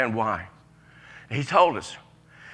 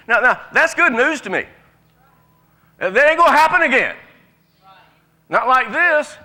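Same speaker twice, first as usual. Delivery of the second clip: excited; 9 semitones higher, 7 dB louder than usual. Real, not acted. Preacher exults over good news.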